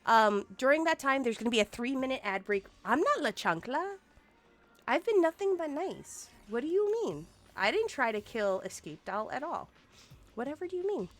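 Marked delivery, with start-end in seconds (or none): 2.8-11.1 mock innocent. Feigned innocent denial.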